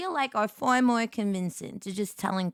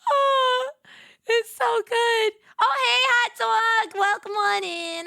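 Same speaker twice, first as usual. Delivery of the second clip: high, louder, slow squealing greeting; delighted squeal; 11 semitones higher, 5 dB louder, slower than usual. Real, not acted. Streamer greeting Hot Dog, high delighted squeal. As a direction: gush over the viewer.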